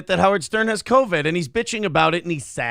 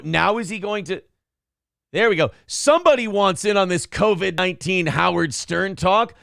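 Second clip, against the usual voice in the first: ordinary talk, nothing marked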